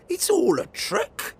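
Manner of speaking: put-on high, loud child voice; a kid who sees through it; indignant child. Gruffalo's child deciding it's a trick.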